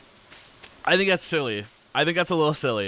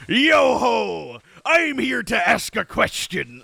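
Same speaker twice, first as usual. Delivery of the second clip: loud, much higher hammed-up pirate hail; 8 semitones higher, 4 dB louder, slower than usual. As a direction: play a jolly pirate hailing the stream. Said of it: "like, in a pirate voice".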